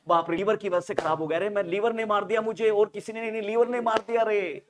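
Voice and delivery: loud, high, hammed-up complaint; mock wailing shout. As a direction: cry out, blaming the liver. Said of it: "CNS चिल्ला रहा है". (ज़ोर से चिल्लाती, नाटकीय शिकायत)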